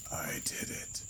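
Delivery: dry papery whisper of a corpse; hoarse whisper. A narrator's reading. Creature rasping to the narrator, hoarse whisper. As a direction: rasp it out like crumpled paper. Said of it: "it muttered, hoarsely".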